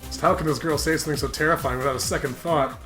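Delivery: ordinary talk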